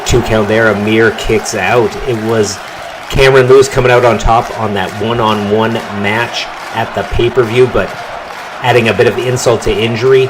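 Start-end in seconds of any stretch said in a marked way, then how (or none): none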